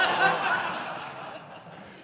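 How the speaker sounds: high, breathy nervous laugh; high embarrassed laugh at the questions. Real, not acted. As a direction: laugh off the pressure. Caught off guard by hard questions.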